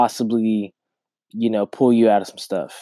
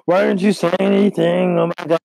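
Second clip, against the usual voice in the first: higher-pitched retold outburst at him; mock scolding; 8 semitones higher, 3 dB louder than usual. Girlfriend scolding him after the snowy drive, higher mock outburst. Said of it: "Almost cussing me out".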